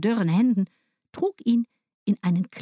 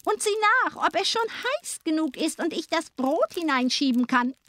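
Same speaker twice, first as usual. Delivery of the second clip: high-pitched, scheming witch voice; commanding witch; 9 semitones higher than usual. A narrator's reading. Witch tricking Gretel at the oven, high witch voice. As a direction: coax and order as the witch. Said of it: "sagte die Hexe".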